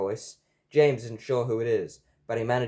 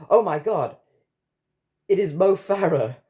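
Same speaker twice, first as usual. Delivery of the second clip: high, loud, thrilled outburst; starstruck exclamation; 7 semitones higher, 7 dB louder than usual. A narrator's reading. James bumps into Mo Farah, starstruck. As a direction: blurt it out, overcome with excitement. James runs into his idol.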